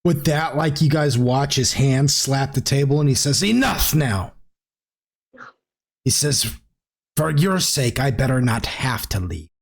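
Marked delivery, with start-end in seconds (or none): 3.4-9.5 a lightly voiced lord warning his guests; menacing host.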